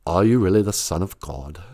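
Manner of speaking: low, solemn Roman governor. Retold or imitated. Pilate questioning Jesus, a low English-accented voice. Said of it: "[name] as Pontius Pilate".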